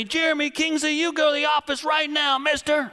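high, loud scolding teacher; teacher yelling. Retold or imitated. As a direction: send the kid to the office. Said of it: "the teacher yells at me".

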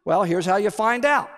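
ordinary talk, nothing marked

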